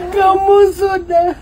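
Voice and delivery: slow, loud, high push on kamu; urging call. Pretend. Father urging baby to tell mama, slow loud call. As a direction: drag it out loud to egg her on.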